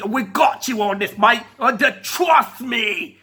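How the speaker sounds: cheeky mock British accent; John Soyega, cheeky British accent. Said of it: "in his cheeky little British accent".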